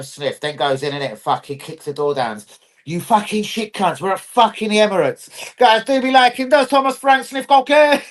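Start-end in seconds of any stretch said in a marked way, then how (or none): 5.6-8.0 high, excited mock-host call; high voice with a held ending; hammed-up host appeal. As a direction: pitch it up to the viewers. Hammed-up appeal to stream viewers.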